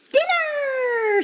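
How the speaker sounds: very high, loud squeal; excited squeal. A narrator's reading. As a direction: squeal with greed. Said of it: "squealed her brothers".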